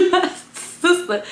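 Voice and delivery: high laughing through words. Real, not acted. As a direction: laugh while you say it. Laughing at the memory.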